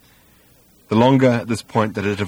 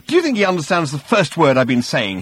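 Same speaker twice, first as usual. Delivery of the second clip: raised, exasperated appeal; quivering with emotion; 6 semitones higher, 3 dB louder than usual. A narrator's reading. Arthur appealing to the old man, emotional. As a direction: appeal for support, worked up. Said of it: "quivering with emotion".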